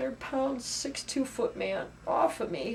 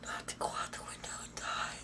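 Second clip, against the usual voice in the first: soft repeat, all breath; whisper; 11 dB quieter than usual. Real, not acted. To the interviewer, reliving the window: shaken whisper. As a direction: breathe the words as the memory hits.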